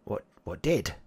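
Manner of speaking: a shifty goblin demanding proof. Acted. GM voices goblin.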